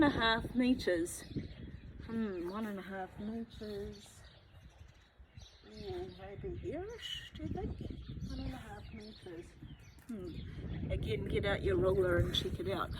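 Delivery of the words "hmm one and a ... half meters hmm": quiet musing. Real, not acted. Measuring with a ruler, musing.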